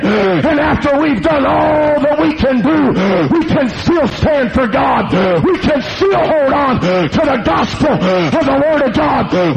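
driving sermon cadence with hard gasps between phrases; full-force preaching. Acted. Warning the congregation about the devil, full-force preaching. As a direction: preach it in urgent, punched phrases.

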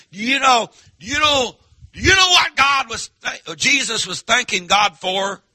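ramp it up each time; building preacher's call. Acted.

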